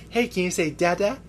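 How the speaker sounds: high-pitched coaxing parent voice; imitating a dad coaxing his kid; dad to baby. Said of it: "He's like".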